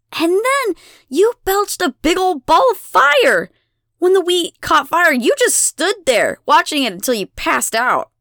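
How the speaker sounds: high, loud tirade, easing slightly; outraged accusation. A narrator's reading. Abigail exposes the goddess before townsfolk, outraged. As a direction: recount it all indignantly.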